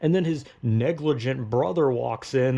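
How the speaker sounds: ordinary talk, nothing marked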